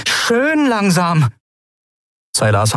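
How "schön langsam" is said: high, slow warning; slow, pitched-up command; demanding. A narrator's reading. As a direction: draw it out as a controlling order. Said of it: "forderte Endriel".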